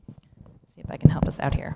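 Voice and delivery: unvoiced whispered aside. Real, not acted. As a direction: whisper it as a private aside to yourself.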